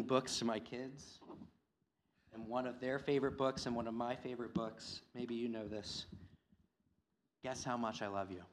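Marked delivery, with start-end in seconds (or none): none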